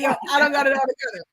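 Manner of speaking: playful quick repeat of the bro line. Retold or imitated. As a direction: toss it off fast and high like a buddy.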